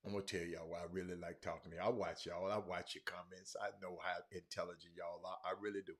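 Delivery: low, very soft sincere aside; softly spoken, low appreciation; quiet confiding. Real, not acted. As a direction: confide in your audience, hushed. Confiding praise to his viewers.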